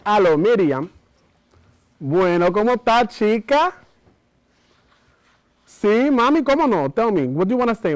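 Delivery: high, loud Spanglish auntie giving a love spell; a bossy, knowing auntie coaching her girl by phone; Dominican auntie on the phone. Acted. Aunt instructs Miriam over the phone on a love spell, high and bossy. Performs solo show character.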